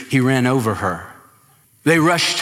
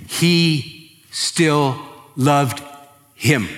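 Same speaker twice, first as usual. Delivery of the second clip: slow tender emphasis; much slower than usual. Acted.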